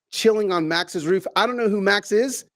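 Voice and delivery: ordinary delivery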